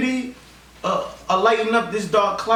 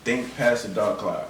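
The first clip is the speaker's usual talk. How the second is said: deep, slow sage voice; put-on deep mentor delivery; wise elder; 12 semitones lower, 5 dB quieter than usual. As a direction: repeat it as a low, slow motto. Repeats motto in elder voice.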